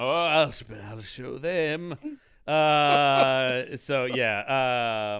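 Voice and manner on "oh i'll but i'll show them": slow, drawn-out hammy vow; James Mason. Acted. Host defying the doubters, slow mock James Mason. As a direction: vow it grandly in an actor's voice. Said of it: "Mason impressions".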